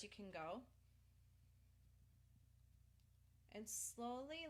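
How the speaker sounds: soft, steady breath-work voice; calm breathing guidance. Acted. Leads breathing during tapping.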